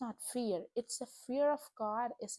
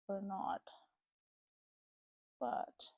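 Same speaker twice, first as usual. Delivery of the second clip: softer, whispered hesitation; hushed, uncertain aside; unsure whisper; 3 semitones lower, 7 dB quieter, slower than usual. Real, not acted. Unsure whether to play music.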